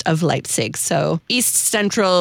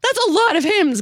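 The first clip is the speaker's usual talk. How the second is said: amazed; 12 semitones higher than usual. Real, not acted. Reacting to hymn count.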